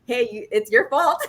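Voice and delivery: teasing blame through a laugh; playful laughing accusation; laughing tease. Retold or imitated. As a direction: laugh as you pin it on him.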